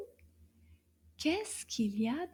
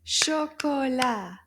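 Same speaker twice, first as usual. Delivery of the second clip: "Chocolat" in three slow beats, a bit louder; syllable by syllable; 5 dB louder, slower than usual. Shown as a demonstration. Teacher tries the syllable count again, "Chocolat" slow and separated. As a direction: beat out every syllable of "Chocolat" firmly. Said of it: "Let's try that again".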